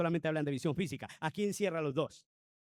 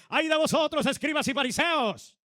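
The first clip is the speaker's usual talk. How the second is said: stern rebuke; 9 semitones higher, 9 dB louder than usual. Acted. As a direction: thunder the woe at the Pharisees. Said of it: "ahí sí utiliza ese tono". (el Señor reprende a los fariseos con autoridad tremenda)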